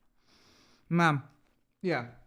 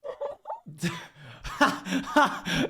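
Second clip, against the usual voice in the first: high laugh. Real, not acted. Laughs at teary clip.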